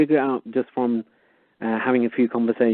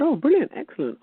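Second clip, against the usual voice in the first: mock delight; 14 semitones higher than usual. Mock delight at tax officer.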